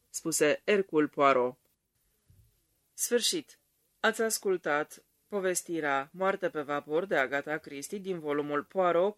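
plain reading voice

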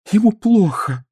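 high-pitched, plaintive distress; anguished. A narrator's reading. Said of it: "с тоской сказала Зоя".

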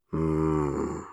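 low, quiet humming moan; low hum. Acted. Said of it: "промычал Зейн".

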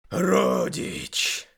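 harsh unvoiced demon cry. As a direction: rasp it out like a demon. Demon speaks suddenly. (хриплый голос демона)

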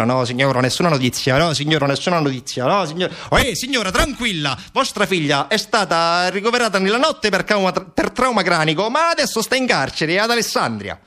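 brush-off repeats rising into high agitation; police phone operator. Acted. Police switchboard answers Sara's worried mother, officious.

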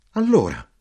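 loud high cry; shout. Acted. Narrator pressing the soldier, shouting. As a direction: call it out sharply.

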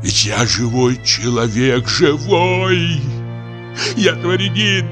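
put-on high, wailing drunken lament; proud recollection swelling into anguished cries; theatrical outpouring with long drawn-out endings; impassioned old actor. Old actor recalls his lost youth.

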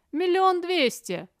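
high, loud, slow bid call. A narrator's reading. Another bidder calls out.

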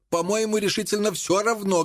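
mild narrated cry, pitched high. A narrator's reading. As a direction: call it out in a high voice, without shouting. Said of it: "крикнул Энсли". (негромкий выкрик высоким голосом)

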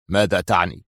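low, mildly uneasy question; tense. A narrator's reading. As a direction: ask with contained tension. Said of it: "سأله موشي في توتر".

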